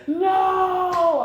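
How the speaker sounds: high, drawn-out shouted no; mock shout. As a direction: shout no like a panicked blocker. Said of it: "They like".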